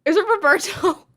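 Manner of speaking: slow, breathless dread. Real, not acted. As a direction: ask it with a sinking fear. Gasp then dread.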